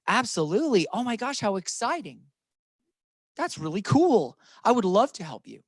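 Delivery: bright, eager, welcoming yes. Shown as a demonstration. New agent answering a request for help, eager. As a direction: sound thrilled to be asked. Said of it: "What you will say is".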